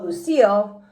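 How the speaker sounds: ordinary delivery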